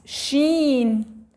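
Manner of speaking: ordinary talk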